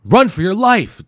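croaked order. Acted. Hero urging Wimple to run, loud. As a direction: push him to flee. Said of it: "Doctor Hayabusa croaked".

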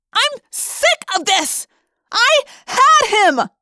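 high, slow, drawn-out shouting; frustrated yell. A narrator's reading. Rachel slamming the tree trunk, yelling in frustration. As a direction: bellow every word in sheer frustration. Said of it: "in sheer frustration".

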